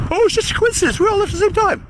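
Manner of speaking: high, sing-song mock innocence; mocking falsetto. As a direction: parrot their excuse in a high sneer. Mocking the others' excuse.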